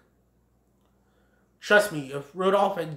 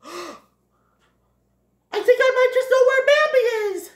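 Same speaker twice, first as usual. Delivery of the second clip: high, loud, fast outburst; excited realization; 8 dB louder, faster than usual. Acted. Thomas realizes where Bambi is, excited cry. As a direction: burst out with the idea, pitched way up.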